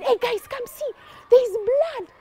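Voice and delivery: high-pitched urgent call; excited discovery. Acted. Soldier calling the others to see the blood, excited. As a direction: call friends over to a startling find.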